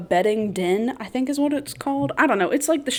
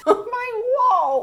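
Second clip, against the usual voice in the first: high, drawn-out mock-crying whine; hammed-up lament, far higher and slower; mock wail; 14 semitones higher, slower than usual. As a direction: wail it in comic despair. Mock lament over stained wall.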